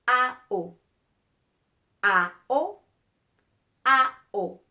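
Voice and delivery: "A-O" loud and clear, open a and pure o; "A-O" loud drill; pure Spanish vowels. Shown as a demonstration. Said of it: "let's say it three times".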